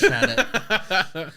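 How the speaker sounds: laughing. Real, not acted. Deadpan sarcasm, laugh.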